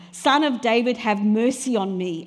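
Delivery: loud, insistent plea. Acted. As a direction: raise the voice and beg for mercy. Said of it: "it said even more loudly".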